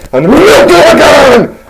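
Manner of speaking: high-pitched burst of mock excitement; excited exclamation. Telling how Betsy got milked again, excitedly exclaimed. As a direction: shoot up high on the milking. Excited climax of absurd milking story.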